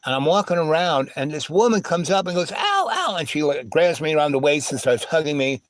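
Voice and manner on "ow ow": high delighted squeals; mock excited squeal. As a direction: squeal with joy at seeing him. Woman greets him excitedly.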